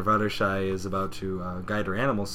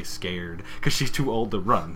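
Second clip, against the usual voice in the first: normal talking voice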